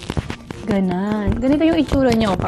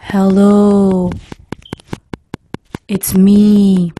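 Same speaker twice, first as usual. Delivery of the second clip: lower, slow, intimate ASMR murmur; 4 semitones lower, much slower than usual. Shown as a demonstration. Vlogger demonstrating ASMR to viewers, lower slow intimate voice. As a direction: lull the listener to close their eyes. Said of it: "sa mga A S M R na nakikita ko".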